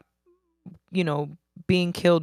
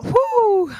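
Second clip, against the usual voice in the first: excited woo; 13 semitones higher, 3 dB louder than usual. Real, not acted. Cheering one more show.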